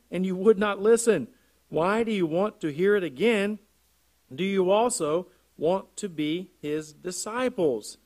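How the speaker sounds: emphatic pulpit reading of a dialogue; scripture reading. Acted. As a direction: proclaim the verse with punched key words. Reads scripture aloud to congregation.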